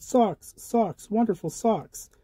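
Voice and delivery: high, joyful calling out of socks; excited yell. A narrator's reading. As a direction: cheer about the new socks. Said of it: "Tina ran home and yelled".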